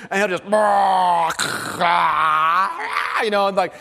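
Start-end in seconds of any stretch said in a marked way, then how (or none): 0.5-3.2 loud playful monster roar imitating a toddler; Godzilla roar. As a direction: roar it out like a kid playing Godzilla. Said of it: "decide it's time to be Godzilla".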